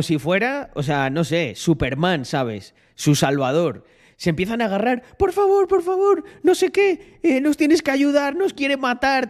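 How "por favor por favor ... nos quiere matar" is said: very high-pitched, frantic begging, imitated; high pleading girls. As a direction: beg for help like a scared girl. Girls begging him for help. (súplica aguda y asustada imitada)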